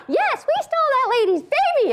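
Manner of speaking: chirpy mock confession; cheery confession. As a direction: blurt out the crime brightly. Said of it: "It's like".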